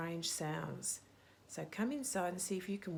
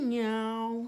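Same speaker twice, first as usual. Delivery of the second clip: louder meow of the hunting cat; 3 semitones higher, 7 dB louder than usual. Acted. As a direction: meow like the cat after them. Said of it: "says the cat".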